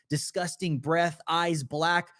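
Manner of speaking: ordinary delivery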